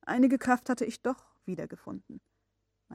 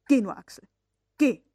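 louder, Geh falling firmly; hard command; 2 semitones higher, 8 dB louder than usual. Acted. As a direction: order him off, hard. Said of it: "mit größter Aufregung".

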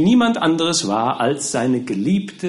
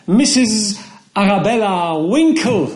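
high, slow, drawn-out announcement; proud proclamation; 8 semitones higher, 6 dB louder, slower than usual. Acted. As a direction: present your new wife with a flourish. Husband proudly proclaims his new wife.

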